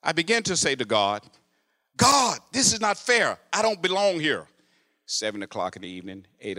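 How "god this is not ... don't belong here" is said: pleading with God. Retold or imitated. Complaining to God in jail.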